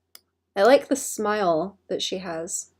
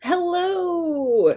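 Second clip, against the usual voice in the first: high sing-song cute greeting; bright cheerful streamer hello; cute anime streamer; 9 semitones higher, 5 dB louder than usual. Real, not acted.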